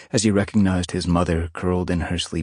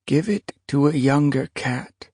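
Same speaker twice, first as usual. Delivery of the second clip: weary she-cat; 9 semitones higher than usual. Acted. Old she-cat refusing prey.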